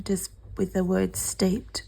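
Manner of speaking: hoarse whisper; raspy whisper. Real, not acted. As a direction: whisper it, voice going. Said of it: "Sorry, I'm losing my voice".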